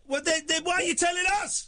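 high-pitched, incredulous exasperation; exasperated challenge. Real, not acted. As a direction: challenge the caller, voice shooting up. Host challenges caller's pointless message.

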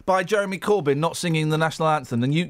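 ordinary talk

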